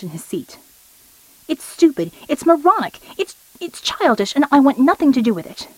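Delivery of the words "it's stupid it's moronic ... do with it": high, indignant protest, speeding up at the end; a giant throwing a tantrum, voice pitched up; higher, exasperated rant; angry outburst. A narrator's reading. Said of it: "the angry Southwind".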